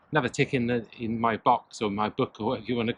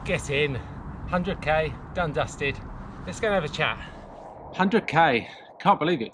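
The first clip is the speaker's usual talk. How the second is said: excited; 5 semitones higher than usual. Real, not acted. Celebrating finishing the hundred K.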